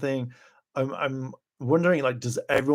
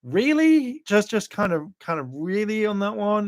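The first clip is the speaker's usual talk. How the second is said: disbelief; 8 semitones higher than usual. Retold or imitated. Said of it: "And I was like".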